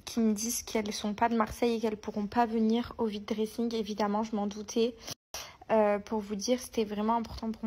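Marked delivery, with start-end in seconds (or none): 0.0-4.9 whispering. Real, not acted. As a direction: breathe it without voice. Whispered throughout.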